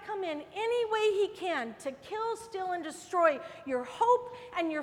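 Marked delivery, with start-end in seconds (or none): none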